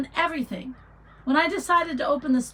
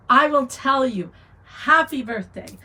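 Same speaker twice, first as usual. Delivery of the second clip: ordinary talk, nothing marked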